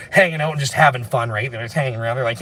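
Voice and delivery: usual speaking voice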